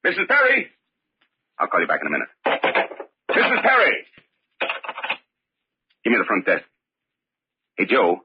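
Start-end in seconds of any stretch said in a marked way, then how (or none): 0.0-0.7 calling out. Acted. Calls after leaving wife. 3.3-4.1 calling out. Acted. Calls after leaving wife.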